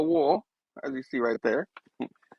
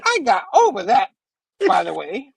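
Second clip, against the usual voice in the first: high-pitched, wisecracking superhero retort; Spidey; 9 semitones higher than usual. Retold or imitated. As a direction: taunt the villain in a much higher voice. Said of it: "And he's like".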